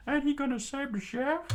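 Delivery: very high, loud fretful question; high worried crew. Acted. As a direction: ask in alarm.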